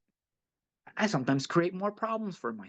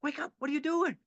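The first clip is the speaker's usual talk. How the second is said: much higher, shaking someone awake; urgent wake-up call; 12 semitones higher than usual. Retold or imitated. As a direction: grab him by the shirt and snap him out of it. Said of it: "someone grab me by the shirt and go".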